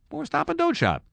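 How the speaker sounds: high squeaky punchline; squeaky joke. Pretend. A host mocking the store in a squeaky falsetto.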